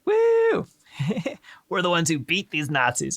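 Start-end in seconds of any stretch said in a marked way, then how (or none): 0.0-1.4 much higher sarcastic "Woo!" whoop; mock cheer whoop. Host joining the sarcastic patriotic cheer, high mock whoop. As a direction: whoop like a fake rally crowd.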